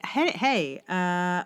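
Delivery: usual speaking voice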